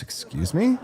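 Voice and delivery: mock shocked. Real, not acted. Host reacts to a cheeky chat message, mock shocked.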